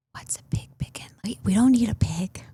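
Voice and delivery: hushed, voiceless aside to a husband; whispered. Retold or imitated. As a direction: whisper it privately so nobody else hears. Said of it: "I whispered to my husband".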